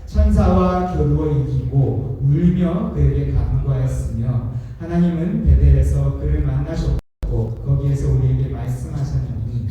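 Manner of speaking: slow, solemn reading of the verse; scripture reading. Acted. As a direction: read the Bible verse slowly and gravely. Reads scripture aloud.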